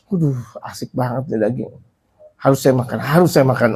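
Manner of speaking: soft, greedy muttering, lost in the smell of meat; a hushed voice that has already given in to appetite; mock greedy craving. Preacher acting out a man craving meat he smells, hushed and greedy.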